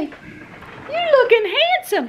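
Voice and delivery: very high, slow cooing. Real, not acted. Owner cooing at her dog in his new wraps, very high and slow. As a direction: coo it to the pet, stretched out.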